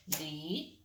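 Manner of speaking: ordinary talk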